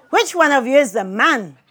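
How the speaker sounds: loud, emphatic demand to know; insistent question. A narrator's reading. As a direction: put the question boldly, every word loud. Father's question repeated with relish.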